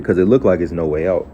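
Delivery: ordinary talk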